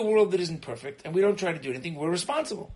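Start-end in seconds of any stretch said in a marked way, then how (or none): none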